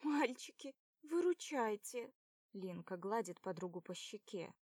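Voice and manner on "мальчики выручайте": put-on higher, louder girl's plea; girlish voice pleading for rescue. A narrator's reading. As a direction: beg the boys for help. Lina begs the boys.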